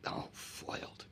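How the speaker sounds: breathy whispered aside, slow; mock defeat. Retelling the kitchen tyrant's defeat, mock foiled whisper. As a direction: deflate it like a foiled villain.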